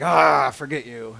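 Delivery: loud, slow mock disgust; dismissive. God imagined giving up on us, mock dismissal. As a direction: groan it out and wave them away. What God would not say.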